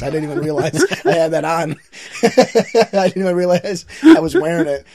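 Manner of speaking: loud laughing talk. Real, not acted. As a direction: crack up while saying it. Laughing at own joke.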